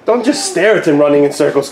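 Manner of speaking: ordinary delivery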